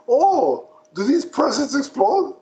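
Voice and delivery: high-pitched, eager retold question; mock excitement. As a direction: play a villain suddenly thrilled, pitched well up. Acting villain's eager question about presents.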